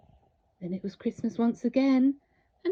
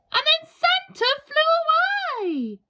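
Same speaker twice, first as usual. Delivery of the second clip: much higher and louder, soaring; dramatic climax; 10 semitones higher, 7 dB louder than usual. A narrator's reading. Narrator as Santa flies off without the dinosaur. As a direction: lift it up like the sleigh flying away.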